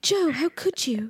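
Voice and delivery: mock faux-English mid-Atlantic accent. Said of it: "old-timey faux English mid-Atlantic accent".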